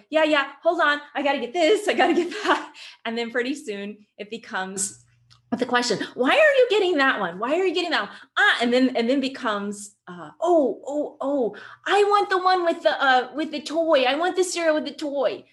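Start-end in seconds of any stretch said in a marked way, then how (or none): 0.1-2.7 distracted parent. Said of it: "And you're like". 6.1-8.1 put-on curious child. Child questioning parent in store. 10.4-15.4 higher, lightly voiced whining child; whiny child. Child begging for cereal.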